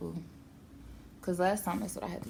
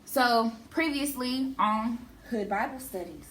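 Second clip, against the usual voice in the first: announcer intro; 5 semitones higher, 4 dB louder than usual. Acted.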